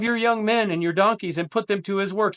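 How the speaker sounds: measured, dramatic Bible reading; solemn scripture read to the congregation. Acted. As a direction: read the passage like a proclamation. Reads scripture passage aloud.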